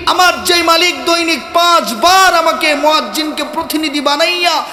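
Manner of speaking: high, loud, driving sermon delivery; full-force preaching. Real, not acted. Preacher says his Master calls him daily, full-force preaching. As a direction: build to a ringing cry on the call to prayer.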